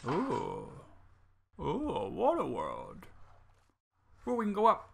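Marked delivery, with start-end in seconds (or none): 0.1-3.1 awed wonder. Real, not acted. Awed at a water world.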